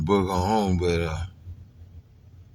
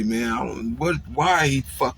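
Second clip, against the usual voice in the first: ordinary delivery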